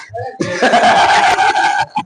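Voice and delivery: long burst of real laughter; real, sustained belly laugh; hearty laugh. Real, not acted. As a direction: laugh out at your own punchline. Laughing at own punchline.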